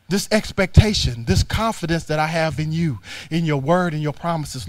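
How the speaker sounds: ordinary delivery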